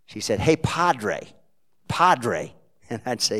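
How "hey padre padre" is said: loud, high, hearty New Jersey greeting; a New Jersey woman hailing her priest friend; Jersey accent. Retold or imitated. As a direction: call out "Hey, Padre" like a Jersey woman. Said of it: "in her broken Jersey accent".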